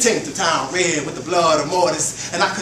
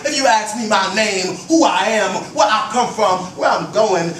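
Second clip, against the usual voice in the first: higher, louder, near shouting; defiant declaration; 6 semitones higher, 5 dB louder than usual. Acted. Poet defying those who question him, loud and high. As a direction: fire it at the questioner.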